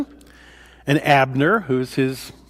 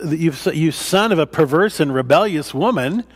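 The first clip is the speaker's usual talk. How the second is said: normal talking voice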